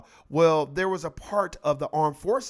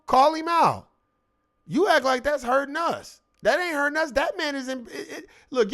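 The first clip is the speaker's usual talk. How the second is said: taunting rant, voice high; high heated taunting; 7 semitones higher than usual. Real, not acted. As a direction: dare them, fired up.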